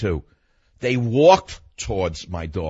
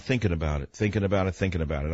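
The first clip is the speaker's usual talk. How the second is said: fading; 5 semitones lower, 5 dB quieter, faster than usual. Real, not acted. Brooding, fading repetition.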